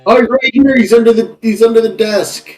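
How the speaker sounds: high-pitched urgent alert; urgent call-out. Acted. Wizard telling an ally he's under the desk, urgently called out. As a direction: call out the hiding place, high and insistent. Calling out enemy's position to ally.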